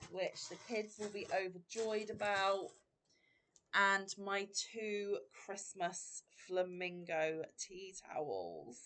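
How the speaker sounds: usual speaking voice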